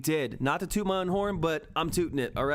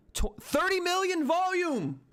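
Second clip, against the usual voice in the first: much higher, slow, yelling with joy; ecstatic shout; 13 semitones higher, slower than usual. Real, not acted. Streamer cheering his viewers on, high ecstatic shout. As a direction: cheer the volume spike at full pitch. Volume spike, live celebration.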